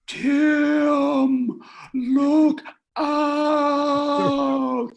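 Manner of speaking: high, loud, drawn-out warning cry; slow-motion shout. Retold or imitated. Storyteller warning Tim of the flying lasso, slow-motion shout. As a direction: stretch each word into a long, loud yell. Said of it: "like, in slow motion saying".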